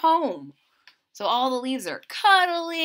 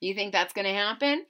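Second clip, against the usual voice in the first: ordinary delivery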